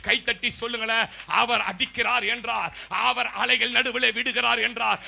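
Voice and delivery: loud, driving prophetic preaching; full-force preaching. Acted. Pastor declaring prophecy to the congregation at full force. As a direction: thunder the prophecy over the church.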